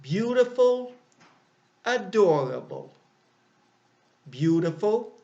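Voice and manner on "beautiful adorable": bright example word. Shown as a demonstration. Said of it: "Here are two examples of long adjectives".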